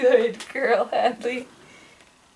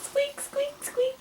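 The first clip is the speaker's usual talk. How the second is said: tiny, very high, soft squeaks; mouse squeak; 7 dB quieter, slower than usual. Shown as a demonstration. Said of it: "How about a mouse?".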